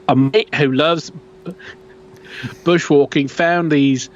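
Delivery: a light attempt at an Australian accent. Podcaster reading a Yowie post in mock Australian accent. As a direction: read the quote in a hammed-up Australian accent. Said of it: "I'm gonna try to do an Australian accent here".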